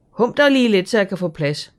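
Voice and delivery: put-on high, loud bossy girl; Asta, bossy. A narrator's reading. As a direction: shove your way in with your voice. Asta pushing in. (højt, bossende pigestemme)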